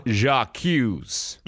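mock French accusation, American accent. American accusing someone in court, with American-accented French. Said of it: "French words in an American accent".

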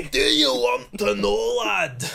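high, slow mock Scottish; Scottish accent. Trading Scottish impressions, high slow brogue. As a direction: lean into the brogue like a hyper old man. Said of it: "when I do a Scottish".

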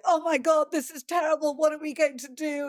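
high panicky mock fretting; freaking out. Someone on set freaking out over a hiccup, high and panicky. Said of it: "freaking out and going".

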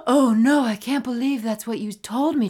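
loud, high melodramatic dismay; mock shock. Host playing the caller's imagined reaction, mock shock. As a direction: overact being aghast at the news. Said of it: "what you imagine their response will be".

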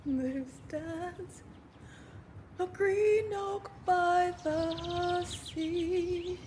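dazed recitation. Acted. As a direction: recite it like a line stuck in her head. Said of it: "I'm losing my mind".

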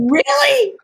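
a big incredulous lift; surprised really. Retold or imitated. Surprised by nephew's news.

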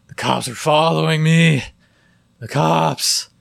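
high, loud, slurring panic; drunk paranoid guy. Retold or imitated. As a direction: play a paranoid drunk begging.

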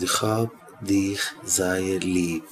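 low, slow and very soft; tender declaration. Retold or imitated. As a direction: tell a troubled child gently, taking time. Tells troubled child of love. (שטיל און מיט ליבשאפט)

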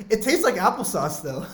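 laughing through the words; giggling while talking. Real, not acted. Laughing at own drink.

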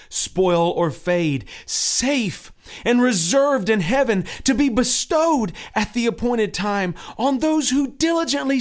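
high, loud pleading pilgrim. Acted.

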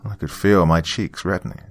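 ordinary delivery

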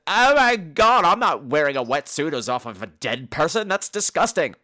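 exaggerated disgust; mock disgusted woman. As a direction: imitate an appalled, whiny refusal. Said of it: "she's like ... That's disgusting".

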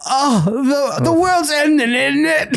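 mock Greta Thunberg. Friends mocking Greta, high pretend panic. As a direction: pitch it way up like a panicked teen.

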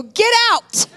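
loud, high joking bark at the nurse; mock yell. Mother joking she ordered the nurse out, mock shout. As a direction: pretend to shove the nurse away for laughs.

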